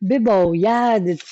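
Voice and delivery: بباید very slow and stretched, falling at the end; drawn-out word. Shown as a demonstration. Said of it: "یه چیزی داخل این قضیه است".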